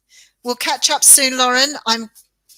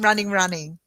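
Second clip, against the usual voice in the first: ordinary talk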